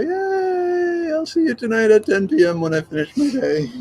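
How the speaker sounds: high, squeaky make-believe joy; mock cheer. Mock cheer about a long day.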